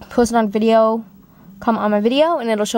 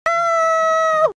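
sharp high scream of fright; high shocked scream. Acted. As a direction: shriek in sudden shock.

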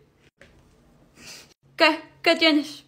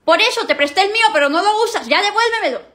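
higher, louder, fed-up scolding; sibling quarrel; 5 semitones higher, 3 dB louder than usual. Acted. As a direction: snap at the brother who takes everything. Siblings quarrel over charger.

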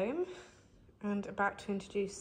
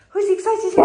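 much higher and louder, squealing with glee; high excited dog voice; 10 semitones higher, 17 dB louder than usual. Acted. Owner hypes the dogs in a high, loud squeal. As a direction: pitch it way up like talking to puppies.

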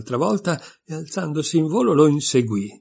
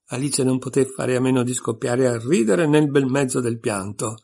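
ordinary delivery, nothing marked

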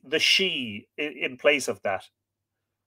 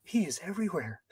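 soft, high feminine whisper; hushed and pitched up, like a frightened woman; light stage whisper; 5 semitones higher, 8 dB quieter, faster than usual. A narrator's reading. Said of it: "she whispered".

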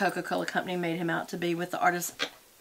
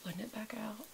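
whispering; 18 dB quieter than usual. Real, not acted. Soft makeup tutorial talk.

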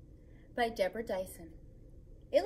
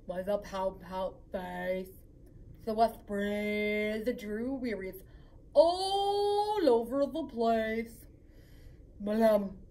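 lower, droning mopey fish voice, slow at the end; glum pout-pout fish; 4 semitones lower, 5 dB louder, slower than usual. Acted. Reading the fish's refrain to kids, glum and droning. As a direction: sulk through it like a gloomy fish.

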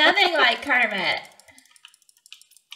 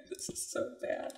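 giggling; 13 dB quieter than usual. Real, not acted. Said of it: "Stop laughing at me".

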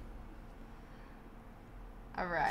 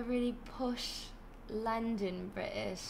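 softer, slower posh London British; posh London accent; 8 dB quieter than usual. Shown as a demonstration. Streamer demonstrating types of British accents, posh London. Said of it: "different types of British accents".

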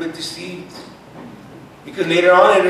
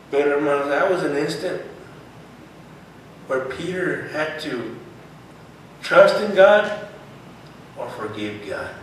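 ordinary talk

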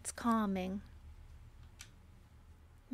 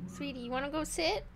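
higher, softer voice praising a good cat; coaxing pet talk; 8 semitones higher, 4 dB quieter than usual. Real, not acted. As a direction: coax the cat sweetly into her bed.

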